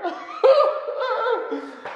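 a long, very high giggling laugh; high giggle. Real, not acted. Shrugging off viewers who dislike his beard, high giggle. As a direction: giggle high as the bluff breaks. Laughs after mock indifference.

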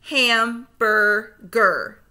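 slow, split into syllables; segmented syllables. Shown as a demonstration. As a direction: chop Hamburger into slow syllables. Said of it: "say the syllables in segments".

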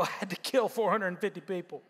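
low, fast, deadpan hero voice; weary Elijah. Acted. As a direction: drop low and toss it off flatly. Elijah's deadpan reply to wife.

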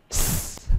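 "S" as a bare hiss; voiceless S sound. Shown as a demonstration. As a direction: sound out "S" alone, with no vowel. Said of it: "nó được phát âm thành ba âm".

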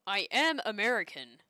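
loud, exaggerated American accent on a protest; fake American accent. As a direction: insist loudly in a put-on American accent. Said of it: "sounds like a British guy trying to be a".

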